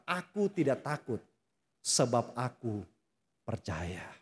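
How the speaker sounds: soft, sincere declaration; quiet faith. Retold or imitated. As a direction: whisper your trust. Quoted confession of faith.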